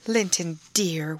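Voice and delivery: plain reading voice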